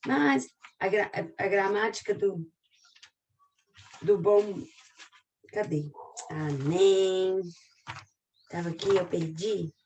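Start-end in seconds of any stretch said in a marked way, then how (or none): none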